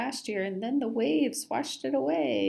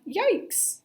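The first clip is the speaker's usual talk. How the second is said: high mock yikes; 12 semitones higher than usual. Fish scared of cat.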